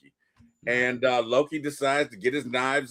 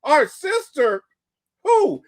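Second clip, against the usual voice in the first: high, slow disbelieving squeak; shocked Thor; 7 dB louder, slower than usual. Retold or imitated. Thor hearing about his sister from Odin, high stunned squeak. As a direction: stretch it out way up in shock. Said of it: "He's like".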